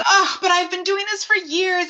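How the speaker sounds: high-pitched mock whine of protest; pleading protest. Protesting doctor's order, retold.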